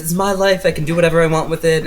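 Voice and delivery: high, quick defiant retort; acted indignant protest; arguing. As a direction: stand up to your parents. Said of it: "him and his parents start arguing".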